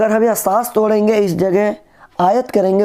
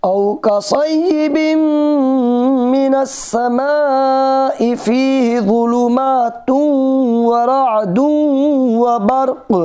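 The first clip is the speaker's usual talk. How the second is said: higher, melodic tajweed chant; Quran recitation; 7 semitones higher, much slower than usual. Shown as a demonstration. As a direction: recite the verse slowly and melodically. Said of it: "गौर से सुनिएगा".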